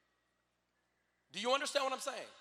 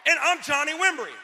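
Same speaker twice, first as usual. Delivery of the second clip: shouted, higher, to the whole room; booming self-introduction; 5 semitones higher, 10 dB louder than usual. Real, not acted. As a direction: announce yourself like a headliner. Announcing himself to the crowd.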